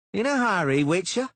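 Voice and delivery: high, loud mocking thug; taunting sneering bodyguard; Cicada voice.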